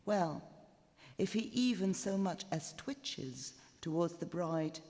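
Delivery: plain reading voice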